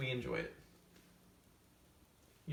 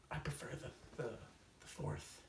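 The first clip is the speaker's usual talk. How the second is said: whispered aside; 12 dB quieter than usual. Acted. Confiding secret preference to camera.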